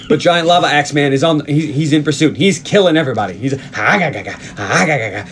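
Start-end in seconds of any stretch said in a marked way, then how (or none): 3.8-5.2 repeated throaty "ah-ga-ga" bursts; mimicked axe-man attack noise. Host retelling the axe man's pursuit, mimicked attack noises. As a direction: imitate a rampaging villain's noises.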